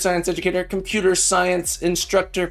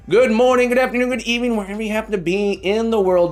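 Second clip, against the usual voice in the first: big, higher announcer welcome; announcer greeting; 6 semitones higher, faster than usual. Acted. Streamer greets viewers everywhere, announcer style. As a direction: greet the world like a radio host.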